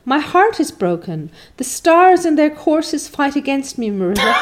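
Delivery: milk the tragedy like a stage heroine; dramatic young Anne. A narrator's reading. Anne's voice, melodramatic.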